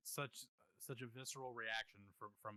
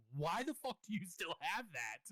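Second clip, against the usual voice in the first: outraged yell, high and loud, drawn out; retold yell; 7 semitones higher, 5 dB louder than usual. Yelling at a buddy who still has the pen, outraged retold yell. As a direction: yell it in disbelief, slowing each word. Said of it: "I immediately yelled at him".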